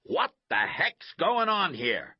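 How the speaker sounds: loud, high, slow coach's demand; exasperated coach yelling; indignant shout. A narrator's reading. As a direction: bellow it at players as you charge over. Coach charges over, demanding answers.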